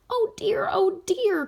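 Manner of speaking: very high, breathy puppy fretting; a scared little puppy gasping in worry; frightened cry. Acted. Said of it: "cried the second little puppy".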